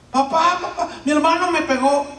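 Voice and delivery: put-on high, loud little-kid whine; tattling child. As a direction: tattle to dad like a small child. (niño acusando con voz aguda y fuerte)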